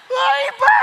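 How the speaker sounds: loud, high delighted gasp of a swooning fan; mock swoon. Woman swooning over a handsome man, loud and high. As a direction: squeal it like she's smitten.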